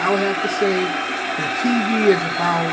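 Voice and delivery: earbud mic, talking loud over crowd noise; bluetooth headset mic. Real, not acted. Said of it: "now the crowd noise is going".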